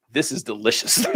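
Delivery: low mock Alabama voice; imitated Alabama accent. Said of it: "sounded like they were from Alabama".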